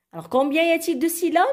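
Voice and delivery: ordinary talk, nothing marked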